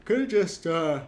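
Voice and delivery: words stretched by a yawn. Real, not acted. Player yawning mid-sentence. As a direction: talk through a big yawn. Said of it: "sorry, I was yawning".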